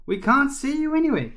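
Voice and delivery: high, loud searching call. A narrator's reading. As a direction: shout to someone you can't see. Calling to a hidden speaker.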